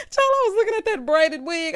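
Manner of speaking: high laugh, talking through it loud. Real, not acted. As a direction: burst out laughing, then keep going. Cracking up at a wig.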